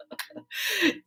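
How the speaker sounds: high breathless giggle; giddy laugh. Real, not acted. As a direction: laugh with embarrassed delight. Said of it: "now I think I'm blushing".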